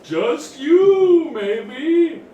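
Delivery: high, teasing creature voice; snail voice. Acted. Snail teases the druid about monsters, high and sly. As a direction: toy with the question, then tease.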